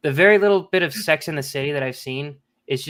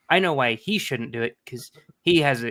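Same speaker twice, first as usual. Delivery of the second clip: usual speaking voice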